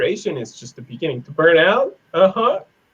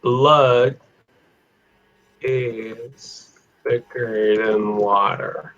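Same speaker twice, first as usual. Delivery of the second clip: drawn-out words with long pauses; word by word, stretched out; slow typing pace; 3 semitones lower, much slower than usual. Shown as a demonstration. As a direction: say it slowly as you type it. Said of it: "Let me type it".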